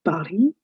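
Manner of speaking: "Paris" as pa-REE, final S silent; French pronunciation. Shown as a demonstration. Teacher repeating the city's name for learners, French pronunciation. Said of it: "The last S is silent".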